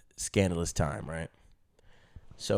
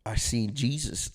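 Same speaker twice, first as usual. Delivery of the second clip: normal talking voice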